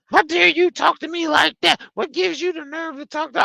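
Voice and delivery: very high mock outrage; hammed-up squeaky indignation; mimicked indignant customer. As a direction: imitate an affronted customer in falsetto.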